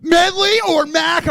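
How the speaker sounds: high, loud, drawn-out pestering yell; annoying mock shout. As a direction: shout it slowly, imitating your pushy old self. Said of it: "I was annoying".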